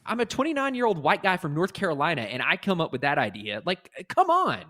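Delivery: worked-up rant, mostly high-pitched; getting heated. Real, not acted. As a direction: rant heatedly, stumbling into the point. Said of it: "I'm getting heated".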